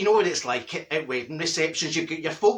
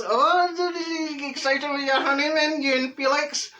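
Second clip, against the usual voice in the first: mock excited; 8 semitones higher than usual. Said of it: "All I say is".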